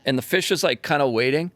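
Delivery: ordinary talk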